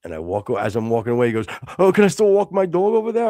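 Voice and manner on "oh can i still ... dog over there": high, loud, quick pleading question; mocking high-pitched imitation; imitating the neighbor. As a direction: mimic a hapless man asking permission. Imitates man's question as he leaves.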